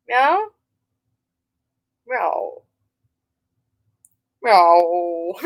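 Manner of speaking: imitated meows, questioning then sinking low; cat meowing. Cat owner asks the cat to talk, meowing.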